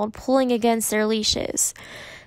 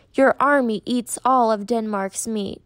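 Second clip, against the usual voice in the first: usual speaking voice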